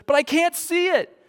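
mock toddler protest, high and insistent; hammed-up child complaint; whining daughter. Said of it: "And she goes".

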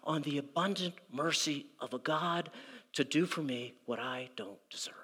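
a humble plea sinking to a whisper; humble surrender. Retold or imitated. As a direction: throw yourself on mercy, hushed. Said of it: "He started out by saying".

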